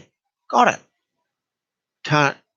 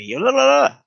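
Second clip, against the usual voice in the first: high mocking la-la-la; sing-song mimicry of a partner's complaints; mocking nagging; 12 semitones higher, 3 dB louder than usual. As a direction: mimic endless nagging, pitched way up. Mimics her going on and on.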